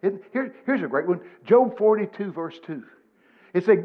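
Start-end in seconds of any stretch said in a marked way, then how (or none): none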